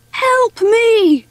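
high-pitched desperate call; shouting for help. A narrator's reading. Stranded mouse calling for rescue, shouting. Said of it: "Mervyn shouted".

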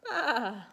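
loud, drawn-out lamb "baa"; lamb's bleat. A narrator's reading. Lost little lamb calling in a children's story, loud bleat. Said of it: "said the little lamb".

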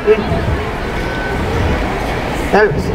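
ordinary talk

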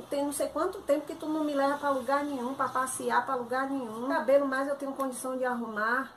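ordinary talk

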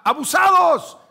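high, loud yell of warning; warning shout. Retold or imitated. Preacher yelling at the soldiers on screen, loud warning shout. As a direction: shout to wake them up. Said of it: "Yo les gritaba".

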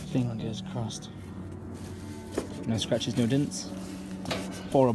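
low, soft murmur with voice; quiet hope. Real, not acted. Man hoping his new barbecue is fine, low and quiet.

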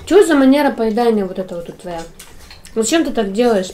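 ordinary talk

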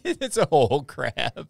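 slow, loud, stressing "a whole crab"; emphatic amazement. Real, not acted. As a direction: drag out "It's a whole crab" in disbelief.

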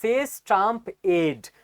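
dragged out, very slow; slowly. Shown as a demonstration. Reading the headline credit slowly to viewers. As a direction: stretch each word out slowly. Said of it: "আস্তে আস্তে বলছি".

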